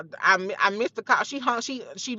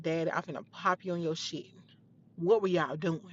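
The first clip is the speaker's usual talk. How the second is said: quiet threat; 4 semitones lower, 8 dB quieter than usual. Retold or imitated. Confronting dad quietly.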